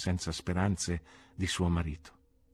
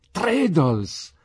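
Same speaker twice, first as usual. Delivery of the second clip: loud, higher triumphant cry; 10 semitones higher, 8 dB louder than usual. Acted. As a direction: announce the name like a winning card. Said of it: "risposi trionfalmente".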